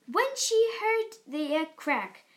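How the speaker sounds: usual speaking voice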